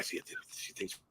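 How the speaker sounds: barely audible whispery muttering; secretive self-talk. Acted. Prankster consulting his imaginary companion about the girl, hushed mutter. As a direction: confer privately with your other self. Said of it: "whole dry ass conversation with me".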